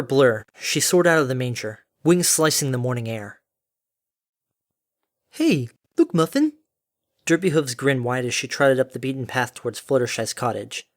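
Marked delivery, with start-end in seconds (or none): 5.3-6.5 high, sing-out mother voice. Acted. Derpy points out the cottage to Muffin, cheery.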